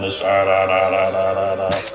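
stuttering machine glitch noise; choppy robotic da da da. Shown as a demonstration. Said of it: "very robotic, stuttering glitch kinda sound".

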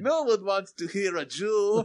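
high, loud exaggerated caricature voice.

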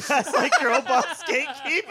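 laughing. Real, not acted. Laughing repeat of the phrase.

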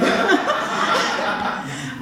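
short nervous giggle; nervous laugh. Real, not acted.

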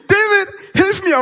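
loud, high, drawn-out mock yell; retold cry for help. Swimmer screaming to his friend for help, retold cry for help. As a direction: yell for rescue, hammed-up. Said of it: "auf Äthiopisch Hilfe geschrien".

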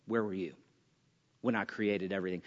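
hushed, low and soft, as God asking Job; God's quiet question. Acted. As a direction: let it drop to a near hush. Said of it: "And God says".

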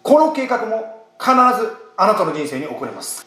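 ordinary talk, nothing marked

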